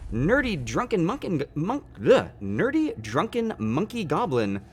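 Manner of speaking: loud, high, fast welcome spiel; fast announcer-like greeting with a laugh in it; streamer shout-out. Real, not acted. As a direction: hype up a new follower, loud and upbeat. Streamer thanks and welcomes a new follower.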